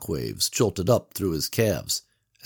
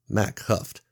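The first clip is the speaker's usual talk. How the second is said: ordinary delivery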